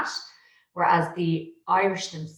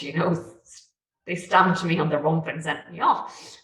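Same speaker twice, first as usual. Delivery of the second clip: usual speaking voice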